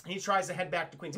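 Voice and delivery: usual speaking voice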